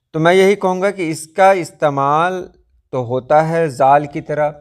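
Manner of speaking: usual speaking voice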